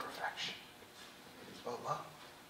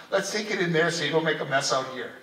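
louder, quick, bustling quote of a kind helper; brisk quoted helper; 10 dB louder, faster than usual. Retold or imitated. Linda at the sacristy steering the priest off, quick and loud. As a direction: rush the line out like someone steering you away from a mess. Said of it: "who meets me at the sacristy and says".

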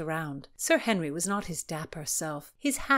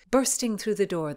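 ordinary delivery

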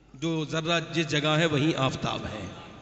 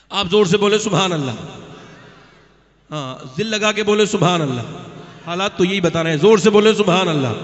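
belted call to the congregation; booming preacher's exhortation; very loud preaching; 14 dB louder than usual. Real, not acted. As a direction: project hard to rouse a whole crowd. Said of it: "میں اتنی زور سے بہت دنوں کے بعد بولا ہوں".

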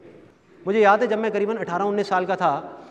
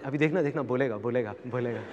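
low, softer aside to onlookers; conspiratorial aside; 5 semitones lower, 8 dB quieter than usual. Retold or imitated. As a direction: imitate an aunt tipping off the others. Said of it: "अपने साथ वालों को बोलती". (धीमे से बगल वालों को इशारा)

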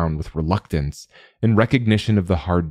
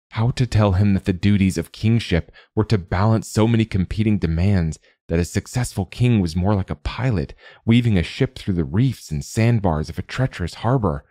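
ordinary delivery, nothing marked

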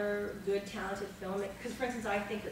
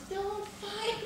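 mock crying plea; 9 semitones higher than usual. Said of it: "Sammy shows up and goes".

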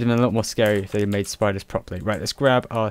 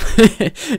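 squealing high laugh; 13 semitones higher, 9 dB louder than usual. Real, not acted. Laughing at his own worry.

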